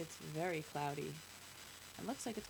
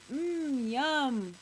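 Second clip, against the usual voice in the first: high, loud, savoring hum; delighted little boy; 5 semitones higher, 7 dB louder than usual. A narrator's reading. Little boy drinking his cocoa, delighted. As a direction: relish it like a happy child. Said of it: "The little boy says".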